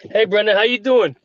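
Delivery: high, bright New York greeting; New York accent. Real, not acted. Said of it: "There's his New York accent".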